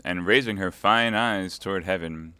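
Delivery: usual speaking voice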